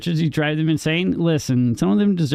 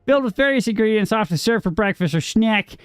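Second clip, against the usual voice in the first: higher, run-on recital of a definition; pointed, higher-pitched reading aloud; 7 semitones higher than usual. Acted. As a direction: recite it like an encyclopedia entry, pitched up. Reads out a dictionary definition.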